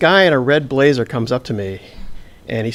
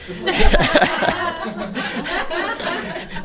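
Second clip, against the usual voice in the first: a high, breathy burst of laughter; real laugh; 4 dB quieter than usual. Real, not acted. Teller laughing at the memory of the cheerleaders, real laugh. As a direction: laugh at your own story. Audience-facing laugh mid story.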